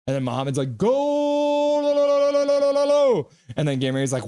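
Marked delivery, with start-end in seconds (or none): 0.8-3.3 much higher, louder chant of goal; hammed-up goal yell. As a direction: yodel the goal like a crazed fan. Acting out a chat goal chant.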